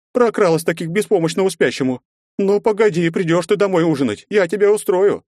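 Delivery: loud, high nagging threats; shrill scolding housewife; scolding mother. Acted. Mother threatens absent daughter.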